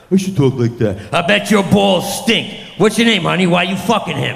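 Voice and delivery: mock a crass comic working the crowd; hammed-up club comic shouting filth; gross club comic. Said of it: "comics who talk like that now".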